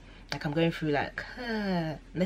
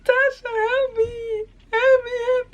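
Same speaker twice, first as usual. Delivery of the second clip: high, slow wailing plea; crying for help; 8 dB louder, slower than usual. Retold or imitated. Begging her friend Tasha, high loud slow wail. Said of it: "I was like".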